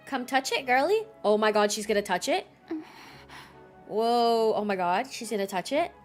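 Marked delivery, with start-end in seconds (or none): none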